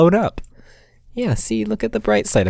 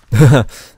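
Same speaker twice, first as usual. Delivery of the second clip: startled laugh; 5 semitones higher, 10 dB louder than usual. Real, not acted. Laugh after the scare.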